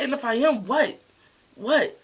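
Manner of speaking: loud, high, defiant comeback; defiant yell. Retold or imitated. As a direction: throw it back at her, daring her. Defiant friend confronted, shouting back.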